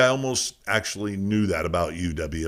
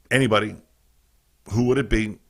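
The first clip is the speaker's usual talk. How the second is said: ordinary talk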